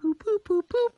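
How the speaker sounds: high beeping boop boop, imitating a phone dialing; phone beeps. Imitating the phone ringing as the butler calls Val, high beeps. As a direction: beep like a phone line ringing through.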